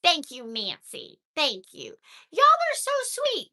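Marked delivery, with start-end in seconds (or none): 2.3-3.5 much higher, gushing "so sweet"; delighted squeal. Real, not acted. Thanking the chat for sweet comments, high gushing squeal.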